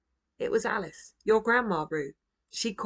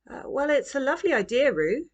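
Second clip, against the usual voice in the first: higher, hesitant mother; hesitant mum; 7 semitones higher, 3 dB louder than usual. A narrator's reading. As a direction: Mum answering Roo's plan, hesitant.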